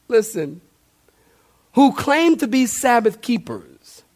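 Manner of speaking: ordinary talk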